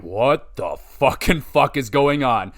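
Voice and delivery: slow, drawn-out bewilderment; stunned disbelief. Retold or imitated. Fan retelling his shock at the attack, dumbfounded. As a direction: stretch each word in baffled shock. Quotes own stunned reaction in crowd.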